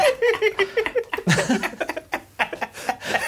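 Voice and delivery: high laughing fit. Real, not acted. Laughing after punchline.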